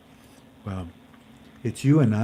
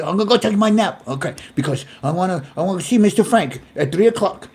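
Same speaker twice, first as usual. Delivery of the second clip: imitating the mom; 8 semitones higher, 5 dB louder than usual. Voices Katarina's mom quote.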